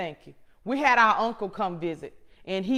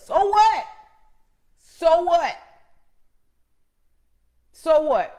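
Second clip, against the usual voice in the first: much higher and louder, brushing it off; defiant so what; 6 semitones higher, 7 dB louder, slower than usual. Real, not acted. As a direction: toss it back, bolder each time. Defiant repeated retort.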